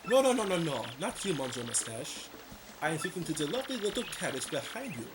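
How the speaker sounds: hammed-up French accent, crooning; a hammy French charmer; mock French flirt. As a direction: sweet-talk her in a fake French accent. French flirt Dupree voiced.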